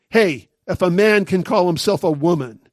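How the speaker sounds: ordinary talk, nothing marked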